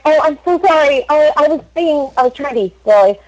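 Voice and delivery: high-pitched, flustered apologizing; flustered apology. Acted. Joker apologizing to an offended American girl, flustered.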